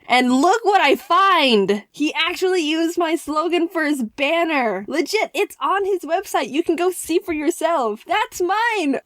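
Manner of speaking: high-pitched, thrilled rush of pride; happy excitement. Real, not acted. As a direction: show off your win, voice soaring. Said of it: "those happy, excited tingles".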